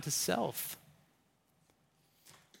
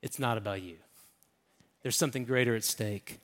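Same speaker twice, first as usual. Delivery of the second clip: soft correction; 8 semitones lower, 9 dB quieter, faster than usual. Retold or imitated. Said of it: "And Jesus says".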